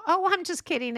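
high, loud fake-cheery retraction; breezy backpedalling. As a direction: brush off your dig as a joke. Friend backpedals after a dig.

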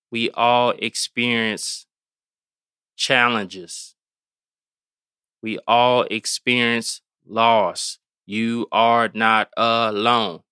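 slow, measured, word by word; unhurried, spaced-out delivery; deliberately slow. Real, not acted. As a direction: say it slowly so it sinks in. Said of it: "Gotta say it slowly".

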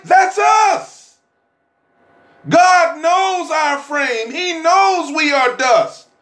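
high, ringing preacher's cry; whooping sermon, slow and high; preaching at full force. Acted. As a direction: lift the church with it. Preaching climax.

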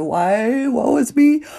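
hammed-up woe, rising high; mock lament. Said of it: "complained about the obstacle and said".

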